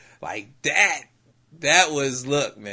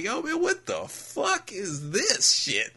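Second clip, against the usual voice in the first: retold laughing disbelief; 8 semitones higher, slower than usual. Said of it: "like, laughing".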